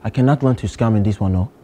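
put-on lightly held Nigerian accent, earnest student; a Nigerian student pleading with the teacher. To a geography teacher, a mild Nigerian accent. Persona at math class.

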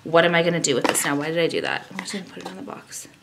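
ordinary talk

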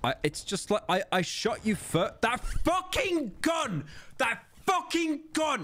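high, drawn-out furious wail; raging gamer. Real, not acted. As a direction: wail it out in disbelief. Raging at enemy's gun.

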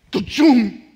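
loud explosive burst; imitated jolt sound. Preacher imitating God's jolt of power, loud burst. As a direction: boom it out like a defibrillator firing.

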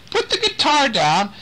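high call with "Put" rising up. Acted. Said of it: "Nick is calling to him".

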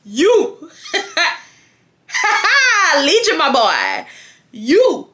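roast him for wanting to go home; sassy scolding rant. Retold or imitated. Scolding boyfriend, retold rant.

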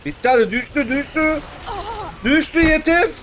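real high-pitched gaming yell; urgent calling out. Real, not acted. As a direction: call it out loudly mid-fight, pitch rising. Urging others during game fight.

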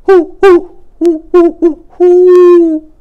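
high, loud hooting owl call; long, ringing owl hoots; great horned owl call. A narrator's reading. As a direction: hoot it out like a great horned owl across the snow. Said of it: "Then Pa called".

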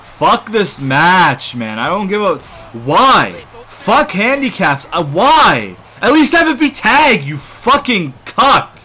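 loud, exasperated gamer rant; really fed up and yelling; angry outburst. Real, not acted. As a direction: rage at the game, hitting the swear words hard. Raging at an unfair wrestling match.

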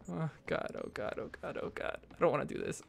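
nervous muttering. Real, not acted. Dreading what waits behind the door.

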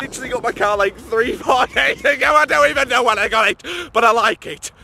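high, loud vlogger babble; over-excited high-pitched boasting; hyped shouting. Acted. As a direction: gush about the car at a shout. Carries on the shouted parody.

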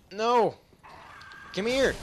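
loud high call; shouting. Real, not acted. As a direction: yell it out to stop him. Calling after a fleeing creature.

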